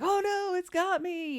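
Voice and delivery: high, loud, hammed-up alarm; mock fright. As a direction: play along with fake terror for a kid. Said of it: "the other parent is all like".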